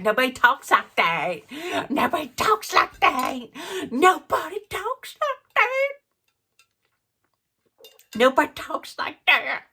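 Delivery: high, odd put-on voice climbing with each repeat; mocking Sutton. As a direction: throw it back as an exaggerated, high-pitched mocking imitation. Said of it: "Sutton had to give it back".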